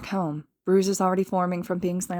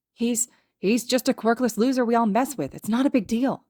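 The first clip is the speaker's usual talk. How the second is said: higher, stammering excuse; panicked; 5 semitones higher than usual. A narrator's reading. Bully caught by a villain, panicked excuse. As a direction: blurt a nervous defence. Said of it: "The bully looked panicked".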